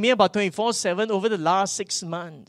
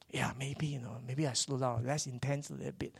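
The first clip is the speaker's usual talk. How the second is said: very soft, low, half-whispered musing; hesitant self-doubt; 4 semitones lower, 12 dB quieter than usual. Acted. Preacher voicing his own wavering after discouragement, hesitant and hushed. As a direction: murmur it as if talking yourself into easing off.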